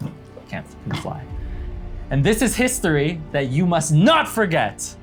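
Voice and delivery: ordinary talk, nothing marked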